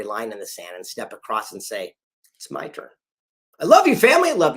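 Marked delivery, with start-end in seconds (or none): 2.4-2.9 hushed resolve; whispered vow. Shown as a demonstration. Declaring one's turn. 3.6-4.6 much higher, loud rallying cry; loud joyful proclamation; shouted declaration. Acted.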